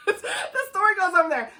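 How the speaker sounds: high, laughing wrap-up; cracking up. Real, not acted. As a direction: push the words out through laughter. Breaks up laughing at plot.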